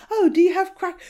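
high-pitched attempt at an English accent; mock English accent. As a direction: Americans badly imitating an English accent. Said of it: "in their best English accent".

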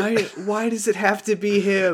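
high, whining protest; exasperated. Real, not acted. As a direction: complain in disbelief. Exasperated complaint.

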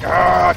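loud roaring battle yell; Predator yell. Retold or imitated. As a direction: roar like the Predator. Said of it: "essentially doing the Predator yell".

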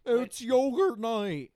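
loud, slow, high silly voice; drawn-out hammy wife. Retold or imitated. Imitating the wife in the movie.

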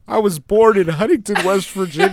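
high, loud hammed-up indignation; mock outrage. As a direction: play the offended guest, over the top. Mock indignant guest rant.

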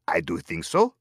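imitated French-accented character. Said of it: "he's got a heavier French accent".

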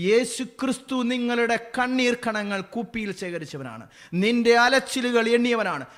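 impassioned preacher at full volume; full-force preaching. Acted. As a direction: thunder the wonder of the verse.

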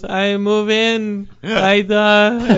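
slow, drawn-out imitation of Tommy Wiseau; mock Tommy Wiseau. Tommy Wiseau claiming charge in a slow, drawn-out impression. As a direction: say it slowly, like an odd self-important star. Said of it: "And he goes".

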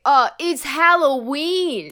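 slow, loud, dead-serious; deadpan matter-of-fact. Pretend. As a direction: state it like it's obvious. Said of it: "look 'em dead in the eyes and say".

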